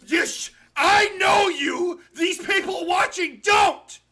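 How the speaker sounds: high, loud outburst of accusation; exasperated yelling. Real, not acted. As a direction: yell it to force the point across. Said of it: "you're screaming at me right now".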